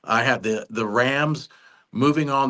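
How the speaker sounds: usual speaking voice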